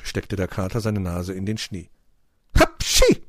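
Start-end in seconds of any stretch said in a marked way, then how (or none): 2.6-3.2 very high, loud "hapschi" sneeze; acted sneeze. A narrator's reading. Cat smelling fox, explosive sneeze. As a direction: burst into a storybook sneeze. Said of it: "nieste er".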